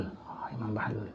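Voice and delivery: hushed whisper of the name. Retold or imitated. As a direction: breathe "Imam Bahlul" secretly. Spotting the wali, hushed.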